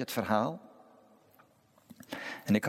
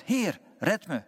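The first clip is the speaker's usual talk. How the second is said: mild narrated cry; 8 semitones higher, slower than usual. A narrator's reading. Peter calls to the Lord as he sinks, mild narrated cry. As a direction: read the plea raised but contained. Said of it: "schreeuwde het uit".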